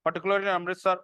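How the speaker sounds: slow, spaced dictation with long pauses. Acted. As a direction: dictate each phrase slowly so students can write it down. Dictating notes to students. (धीमी आवाज़ में notes लिखवाना)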